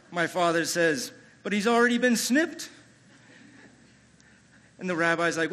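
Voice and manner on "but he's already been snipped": high, loud objection in imitation of a baffled dad; acted protest. Father objects to the rabbi about circumcision, high-pitched protest. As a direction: protest in a raised, higher voice.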